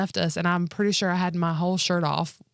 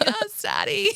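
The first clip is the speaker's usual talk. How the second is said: high, louder, laughing through it; mock sultry, laughing; 3 dB louder, slower than usual. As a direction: tease with a joke sexy voice. Joking sexual imitation.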